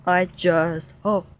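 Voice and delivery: slow, low, drawn-out longing; sad lament. Acted. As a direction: stretch each word like a lonely wish. Dino's lonely lament, long held.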